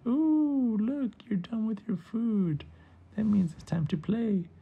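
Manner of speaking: put-on high, eager kid voice; high-pitched, loud opening "ooh", then eager chatter; excited little boy. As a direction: pounce on playtime with glee. Boy eager to play with gecko.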